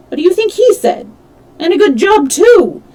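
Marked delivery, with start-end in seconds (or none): 1.6-2.8 higher and louder dismissive snap; rude retort. A narrator's reading. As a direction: toss it off mockingly.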